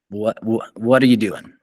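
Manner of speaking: ordinary delivery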